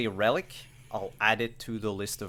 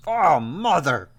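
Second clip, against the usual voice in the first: exasperated groan; 5 semitones higher, 8 dB louder, slower than usual. Acted.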